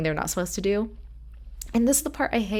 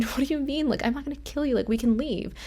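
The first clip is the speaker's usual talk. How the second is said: retelling Darlene's panic in a higher voice; mock panicked protest, pitched up; imitating freaked out; 7 semitones higher than usual. Podcaster retelling Darlene refusing to shoot DDP, higher and panicky. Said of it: "And Darlene's freaked out".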